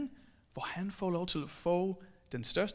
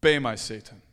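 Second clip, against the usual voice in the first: lower, louder commanding rebuke; Jesus rebuking Peter, lower and loud; stern Jesus rebuke; 9 semitones lower, 9 dB louder than usual. Acted. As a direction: cast him off with authority. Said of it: "hvad siger Jesus".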